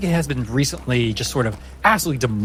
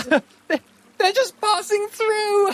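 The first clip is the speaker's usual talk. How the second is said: much higher, slow laughing sarcasm; mocking laugh; slower than usual. Anaïs ridicules the party to her beastkin, laughing high. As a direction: ham up the disbelief for the crowd.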